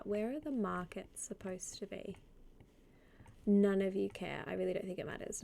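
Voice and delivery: normal talking voice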